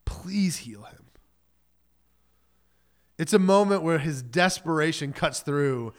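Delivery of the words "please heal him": slow whispered begging; whispered plea. Retold or imitated. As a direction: breathe the plea out, barely voiced. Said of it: "He begs".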